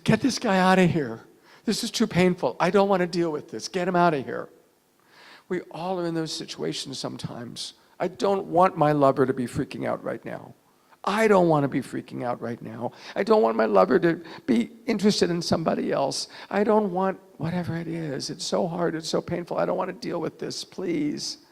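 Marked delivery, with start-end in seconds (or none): 0.0-4.5 raised, high voice wanting out; overwhelmed. Acted. Acts out panicked wish to escape. 8.0-21.4 high, distressed pleading; freaking out. Acted. Voices inner freak-out, pleading.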